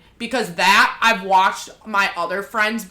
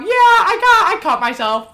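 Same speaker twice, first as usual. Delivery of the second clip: higher, louder mock confession; retold confession; 11 semitones higher, 7 dB louder than usual. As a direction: blurt it out in a raised retelling voice. Retells confessing to mom.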